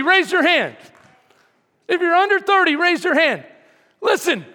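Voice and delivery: loud rousing preaching; rally the crowd; booming motivational speech. Acted. Rousing the congregation.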